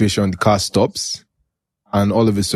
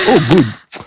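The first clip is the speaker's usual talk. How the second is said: higher and louder, mock delighted; mock happy; 6 semitones higher, 4 dB louder than usual. Pleased that officials ensure his safety, mock delighted. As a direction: gush like it's great news.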